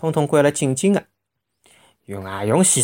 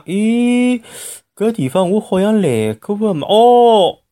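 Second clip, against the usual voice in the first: surprised; 8 semitones higher, 5 dB louder, slower than usual. A narrator's reading. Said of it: "突然之间讲".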